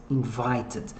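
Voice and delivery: Invited with a light clipped t at the end; Invited, quiet and slow, crisp final t; soft final -ed. Shown as a demonstration. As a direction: say Invited softly, landing on the final t. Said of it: "Olha o E Dzinho, como é que pronuncia".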